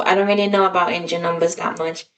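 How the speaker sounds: ordinary talk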